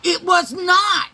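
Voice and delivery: shouted high and slow, outraged "It was not"; shouted denial. Retold or imitated. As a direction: yell it back even harder. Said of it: "I said, Yes, it is".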